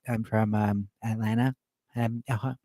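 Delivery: low, soft, hesitant mock mumble; imitating a barely audible shy reply; mumbling nerd. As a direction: mumble it as if afraid to project. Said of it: "half of these nerds were like".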